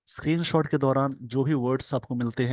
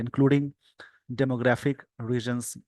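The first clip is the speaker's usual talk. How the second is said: slow dictation; much slower than usual. Shown as a demonstration. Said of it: "slow dictation reading".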